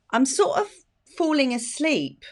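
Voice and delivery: a light, unsure client asking; imitating a client. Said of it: "clients will say".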